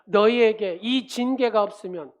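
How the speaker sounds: loud, fervent sermon delivery; preaching. Acted. Pastor preaching to the congregation, loud and fervent. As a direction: preach it out to the whole church.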